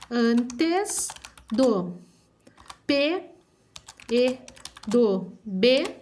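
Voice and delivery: word by word, with long pauses; slow dictation. Shown as a demonstration. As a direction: dictate for children writing it down. (ditado pausado, palavra por palavra)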